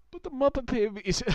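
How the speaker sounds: choking mid-laugh; coughing, choking on a drink. Real, not acted. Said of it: "excuse me, I'm choking".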